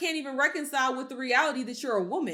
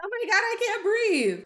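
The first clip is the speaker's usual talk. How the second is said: sarcastic high-pitched imitation of her gasping; mock panicked plea; 10 semitones higher than usual. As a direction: squeal it like a hammed-up victim. Mocking the woman's plea.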